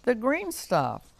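high, drawn-out amazed squeak; squeaking bunny. A narrator's reading. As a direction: squeak it out in wonder. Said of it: "squeaked Bunny Hopwell".

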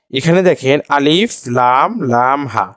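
normal talking voice

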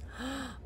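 hushed gasp of wonder; soft gasp. Acted. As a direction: draw in a quiet amazed breath. Anne sees the dress.

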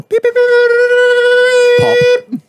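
very high imitated electronic beeping; laser level beeps. Host mimicking builder's laser level, piercing high beeps. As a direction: squeak out machine beeps, drawing them out.

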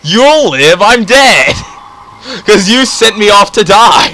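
loud outraged shouting; mock outrage. Real, not acted. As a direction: protest loudly.

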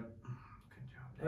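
voiceless, very soft aside; imitated whisper. Friend signalling across the ceremony, imitated secret whisper. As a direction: mouth it with barely any sound. Mimes silent message during ceremony.

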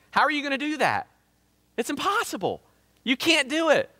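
high-pitched, incredulous preaching; incredulous protest. Real, not acted. Rhetorical protest at impossible debt.

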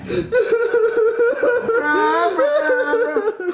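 long high wailing sobs; exaggerated bawling. Father weeping at table.

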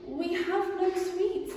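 small and meek; tiny ants. Acted. Ants answering the rat, tiny and meek. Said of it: "the ants replied".

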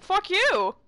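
loud, high angry yell; defiant shout. Real, not acted. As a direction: yell it right back. Yells back at the villain.

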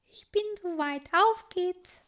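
a shy little titmouse speaking; young bird girl. Acted. Clotilde voiced by reader.

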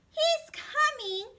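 loud, high panicked shout. Acted. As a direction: yell the warning in alarm. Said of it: "shouted the second little pig".

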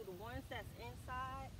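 normal talking voice

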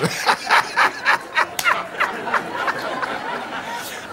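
high, breath-starved cackle; wheezing laughter. Real, not acted. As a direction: collapse into helpless wheezy laughing. Cracks up at own English.

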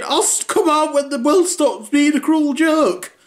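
high, put-on moping teenager; sulky teen voice. Teen son sulking in bed on his birthday. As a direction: whine it like a dramatic teen.

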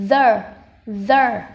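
"the" with a buzzing TH, like a slanted Vietnamese đờ; loud, clearly voiced TH on "the". Shown as a demonstration. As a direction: model "the" slowly with throat buzz on TH. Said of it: "nó bị nghiêng ấy".

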